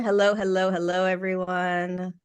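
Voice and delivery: usual speaking voice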